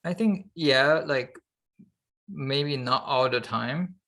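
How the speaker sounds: ordinary talk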